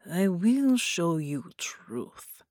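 very slow, whispered promise. Acted. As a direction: lean in and breathe the promise. Slower whispered repeat.

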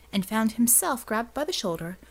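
usual speaking voice

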